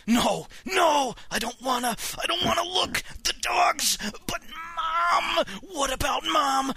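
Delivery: high, distraught wailing; tearful panic. Acted. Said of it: "my eyes started to tear up".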